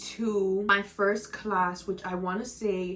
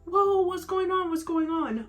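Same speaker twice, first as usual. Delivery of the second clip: startled classmates; 9 semitones higher than usual. Retold or imitated. Classmates react to her crying.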